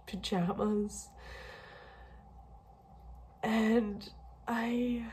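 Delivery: soft, whispered words through tears; barely holding together, half whispering; tearful, hushed and breaking voice; real crying. Real, not acted. Crying in the baby aisle.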